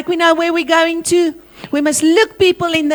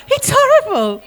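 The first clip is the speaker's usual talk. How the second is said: laughing disgust; 9 semitones higher than usual. Retold or imitated. Disgust recalling rotten food.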